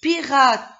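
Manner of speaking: high, loud "patate"; teacher revealing the word to children, triumphantly. Shown as a demonstration. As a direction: burst out "patate" like a prize. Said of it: "j'aurai le mot".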